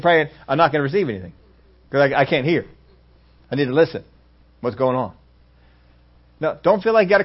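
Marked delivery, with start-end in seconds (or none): none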